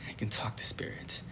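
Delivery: unvoiced secretive whisper. Real, not acted. Vlogger confiding to viewers, whispered. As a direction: let them in on a secret.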